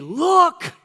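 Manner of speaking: high, loud single call "Look"; loud voice from the throne. Acted. Voice from the throne calling everyone, loud and high. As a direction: call out "Look" to get all eyes. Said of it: "a loud voice from the throne saying".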